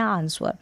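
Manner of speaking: आंसर misread as "aans-var"; common misreading with W. Shown as a demonstration. Teacher imitates how people often misread it, W-sounding आंसर. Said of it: "जो कि हम पढ़ देते हैं".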